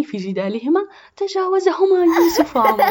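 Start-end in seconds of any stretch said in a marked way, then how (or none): 1.2-2.8 high, loud, laughing as you tell it; laughing narration. A narrator's reading. Narrator laughs as the boys pass the quarreling girls. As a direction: laugh through the line.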